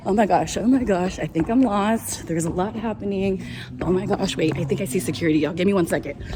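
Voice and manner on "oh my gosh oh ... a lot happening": usual speaking voice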